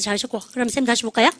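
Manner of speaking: ordinary talk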